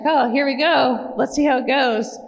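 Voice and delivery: lightly acted wary optimism; nervous here-we-go thought. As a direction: voice your old uncertain self, half joking. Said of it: "I was like".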